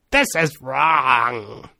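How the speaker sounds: very slow, drawn-out mock scream, held back in volume; screaming inner voice. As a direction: stretch each word like a nagging voice in the head. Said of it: "right now it's screaming at me".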